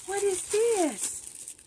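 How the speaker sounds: high, very slow, drawn-out question; delighted wonder. Real, not acted. Discovering the next gift from Cindy, high drawn-out wonder. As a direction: stretch it out high in happy surprise.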